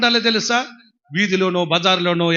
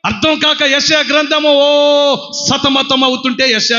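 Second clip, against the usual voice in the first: high, loud fiery preaching; preaching at full force; 7 semitones higher, 3 dB louder than usual. Acted. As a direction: proclaim it to the congregation.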